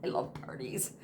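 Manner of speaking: whispered, breathy confession. Real, not acted. Telling viewers about parties, whispered. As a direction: lean in and whisper it like a secret.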